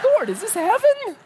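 very high, squeaky awe; mock wonderment. Preacher pretending he died and reached heaven, mock awe. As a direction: ask it in a tiny, awestruck falsetto.

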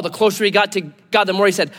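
ordinary delivery

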